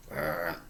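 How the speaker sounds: low soft growl; bear growl. A narrator's reading. Bear answering the grandmother's greeting with a low growl. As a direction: rumble it like an old bear. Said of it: "growled Old Bear".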